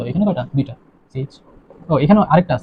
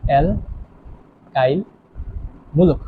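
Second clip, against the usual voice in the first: Alkyl মূলক drawn out very slowly, part by part; slow dictation; 2 semitones lower, 6 dB quieter, much slower than usual. Shown as a demonstration. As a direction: stretch alkyl, pause, then মূলক, as if writing it. Teacher spells out term while writing. (লেখার তালে খুব ধীরে টেনে টেনে)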